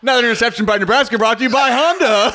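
high, laughing, rushed sponsor plug; mock announcer ad read. Co-host mocking the announcer's sponsor plugs, high laughing ad read. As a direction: slip the ad in like a radio announcer. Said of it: "advertisements that dude has to read".

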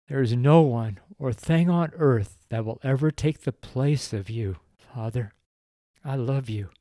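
tender spoken prayer to the Father, close to tears; a pastor praying aloud for his readers, tender and earnest; heartfelt prayer. Acted. Leads a closing prayer.

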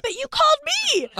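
wife protesting to her husband on the phone; mock indignant. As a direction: protest through a laugh. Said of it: "I'm like".

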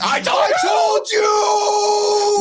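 high, drawn-out triumphant shout; imagined victory gloating. Pretend. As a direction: crow it like your team finally won. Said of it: "that'll be me like".

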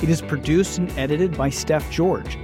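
normal talking voice

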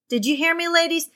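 normal talking voice

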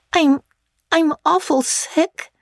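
put-on high, slow, thick-tongued sick girl; a little thickly. Tipsy girl telling her friend she feels sick, thickly. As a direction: slur it like she's unwell. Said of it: "she said, a little thickly".

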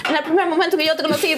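high, rushed recital with laughter; super fast speech. Retold or imitated. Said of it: "lo dice súper rápido".

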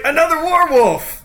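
high, loud cry of alarm; acted startled yell; alarmed bandit shout. Bandit spotting Kevin upstairs, alarmed shout. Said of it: "He's like".

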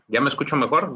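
ordinary talk, nothing marked

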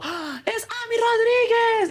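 high, slow fan shriek of a name; mock a teen fan screaming; girl shrieking. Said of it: "una niña gritó". (chillido agudo y lento de fan)

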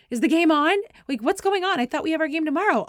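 high, loud imitation of a confused caller; shocked disbelief. Coach calling mid-game, retold shocked confusion. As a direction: act out an alarmed phone question.

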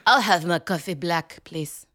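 slow, put-on Italian accent over breakfast; mock Italian accent. Host mocking an actress's method accent, mild Italian accent. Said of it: "I spoke with an accent".

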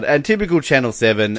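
ordinary talk

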